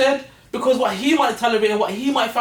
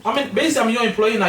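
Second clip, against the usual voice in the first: indignant; 6 semitones higher, faster than usual. Real, not acted. Indignant retelling, laughing.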